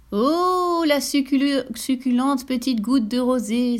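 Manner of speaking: high, greedy gush of delight; butterfly exclaiming at the juicy dewdrop; delighted exclamation. Acted. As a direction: drool over a tasty treat. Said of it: "s'écria-t-il".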